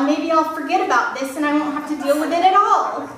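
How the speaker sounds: ordinary delivery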